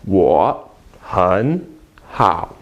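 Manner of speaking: "Wo hen hao" with hen rising between low Wo and hao; tone change demo. Shown as a demonstration. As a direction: lift "hen" in second tone, keep "Wo" and "hao" low. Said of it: "make this one second tone".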